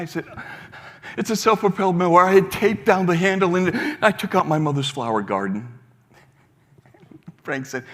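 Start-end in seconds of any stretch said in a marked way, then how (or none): 1.2-5.8 loud, higher and fast; distressed outburst. Retold or imitated. Young man confesses the wrecked garden, loud and rushed. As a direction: spill the confession in a panic.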